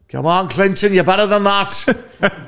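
high, slowing Liverpool heckler impression; heckler's voice. Audience member reproaching the comedian, put-on heckler voice. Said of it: "and a voice went".

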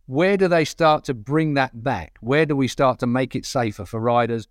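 ordinary talk

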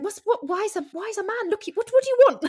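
high, bewildered and rising to a loud cry; like an alien who has never seen a dad; baffled alien. Retold or imitated. [name] baffled by Jack Dee waiting in the rain, high and bewildered. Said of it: "I was like".

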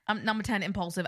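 ordinary talk

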